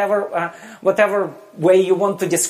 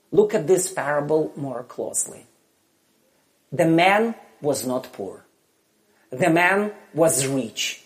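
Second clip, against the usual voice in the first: ordinary talk, nothing marked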